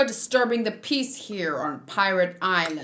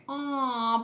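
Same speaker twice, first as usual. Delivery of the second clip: usual speaking voice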